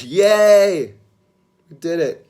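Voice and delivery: loud, high yay of delight; excited cheer. Real, not acted. Mailman just delivered [name] merch.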